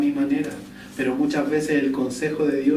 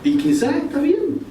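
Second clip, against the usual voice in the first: ironic concession; 11 semitones higher than usual. Real, not acted. Grudging concession mid-sermon.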